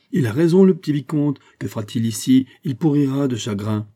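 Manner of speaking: high, loud outburst easing off; emphatic duke, starting at full voice; exclaiming. A narrator's reading. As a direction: cry out in vigorous support. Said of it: "s'écria le duc".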